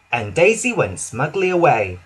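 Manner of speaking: usual speaking voice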